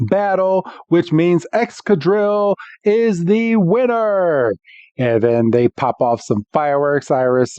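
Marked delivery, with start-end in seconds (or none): 0.1-4.5 lightly voiced ring announcer, higher and drawn out; a hint of match announcer; mock announcer. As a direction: declare the winner, stretching it out.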